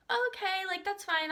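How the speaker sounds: high, put-on agreeable voice; high-pitched agreement. Customer telling the counter staff it's okay, high-pitched agreement. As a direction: go high and play along.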